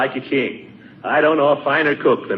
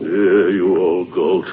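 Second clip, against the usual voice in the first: grumbled insult; 6 semitones lower, much slower than usual. Acted. Insulting the judge aside.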